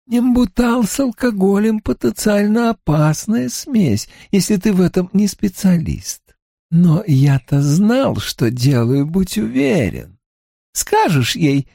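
calm, relishing serial killer; lightly voiced killer Creed. A narrator's reading. (слегка озвученный маньяк Крид)

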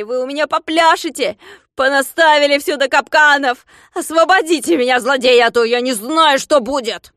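very high, loud frantic shouting; frantic angry yelling. Acted. Klops stuck in the trap yelling at servants, frantic shouting. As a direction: scream for help and curse.

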